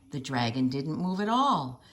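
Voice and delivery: plain reading voice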